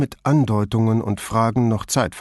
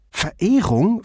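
high, questioning repeat of the word; puzzled disbelief, pitch jumping up; incredulous echo; 10 semitones higher, 3 dB louder than usual. A narrator's reading. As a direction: echo it as if hearing the word for the first time. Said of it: "als höre sie dieses Wort zum ersten Mal".

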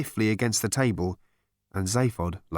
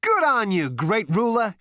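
high, exuberant toast; hearty, high-pitched approval; jovial cheering; 11 semitones higher, 3 dB louder than usual. A narrator's reading. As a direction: cheer him on in a raised voice. Zaphod merrily toasts the ruler.

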